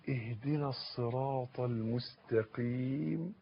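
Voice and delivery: slow, soft supplication; pleading request. Shown as a demonstration. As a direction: recite it softly as a plea, drawn out. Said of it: "اطلب". (تلاوة بطلب ودعاء هادي)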